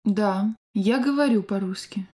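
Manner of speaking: ordinary delivery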